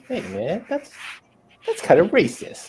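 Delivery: high-pitched comic protest; mock offended. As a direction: play at being affronted.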